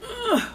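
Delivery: high effortful ugh; straining grunt. Real, not acted. Lifting the heavy glass table, straining grunt. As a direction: strain against the weight.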